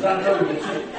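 ordinary talk

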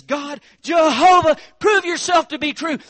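pleading at the top of the lungs; fervent prayer shout. Acted. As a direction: cry out to God with everything. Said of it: "he prays and he says".

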